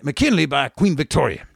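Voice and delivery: ordinary talk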